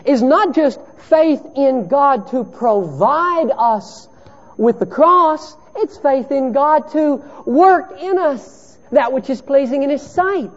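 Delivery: high, loud, driving pulpit voice; impassioned sermon delivery; fervent preaching. Acted. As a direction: preach it out to the whole room. Preaching at full force.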